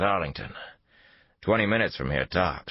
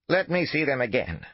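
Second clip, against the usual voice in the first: put-on elderly woman voice; 9 semitones higher than usual. A narrator's reading. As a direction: proclaim it like a wary old lady. Old woman at the door.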